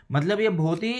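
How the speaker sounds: usual speaking voice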